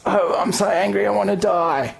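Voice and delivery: lightly hammed-up sulky prophet moaning; whiny Jonah. Preacher mocking sulky Jonah, in a lightly whiny voice. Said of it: "Jonah has totally lost it here".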